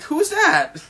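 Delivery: very high-pitched astonished query; startled question. Acted. Crew wonders who just appeared, high and startled. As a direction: squeak it out in mock surprise.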